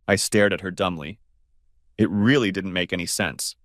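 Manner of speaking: plain reading voice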